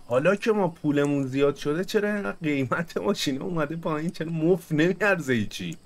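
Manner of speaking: ordinary delivery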